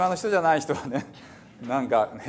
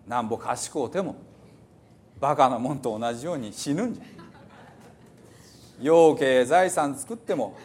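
put-on reading scripture in old Hiroshima dialect, preacherly and raised; 5 semitones higher than usual. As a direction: declaim the psalm like an old Hiroshima elder. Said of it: "ちょっと古い広島弁ですね". (古い広島弁で朗読)

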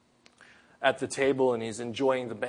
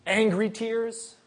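rising emphasis; 9 semitones higher, slower than usual. Real, not acted. Preacher names kinds of tears.

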